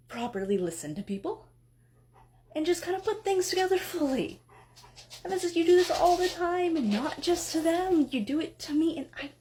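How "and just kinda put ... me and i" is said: higher and louder, exasperated scolding; angry outburst. Acted. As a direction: let the frustration burst out at him. Wife explodes at husband.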